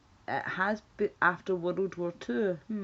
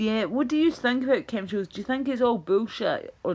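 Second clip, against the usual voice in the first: raised, higher eager question; louder question; 5 semitones higher, 6 dB louder than usual. Real, not acted. Asking the viewers about chemtrails, loud and eager. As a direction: call out to the chat.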